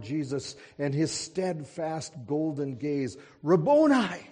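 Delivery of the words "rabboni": a sudden, much higher and louder cry of recognition; joyful cry. A narrator's reading. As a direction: burst out in overwhelmed joy. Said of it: "she cried".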